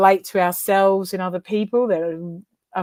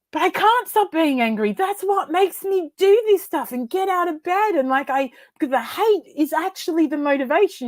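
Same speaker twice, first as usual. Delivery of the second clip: high, agitated confession; 9 semitones higher than usual. Retold or imitated. Retold confession during the argument.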